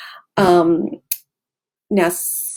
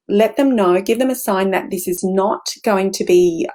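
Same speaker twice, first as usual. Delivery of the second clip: ordinary talk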